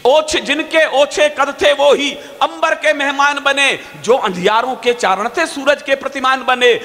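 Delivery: rising, slow patriotic declamation; fiery poem recital. Acted. Poet recites the fresh line to the audience, fiery. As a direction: build the verse like a rally cry.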